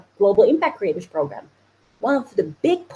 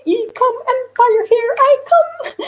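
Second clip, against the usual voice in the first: high, slow, gleeful triumphant call; excited cry; 13 semitones higher, slower than usual. Pretend. Coach hyping her viewers, high excited cheer. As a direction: belt it out like you've already won.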